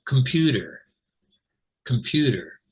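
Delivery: "computer" in American accent, T softened to a D; American "computer", tapped T in the last syllable. Shown as a demonstration. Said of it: "with my American accent here as well".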